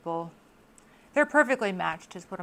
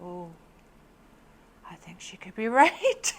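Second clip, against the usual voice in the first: unvoiced whisper with a laugh; whispered, giggly realisation; awed whisper; 3 dB louder than usual. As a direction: breathe it out as a secret realisation. Hushed awed reaction.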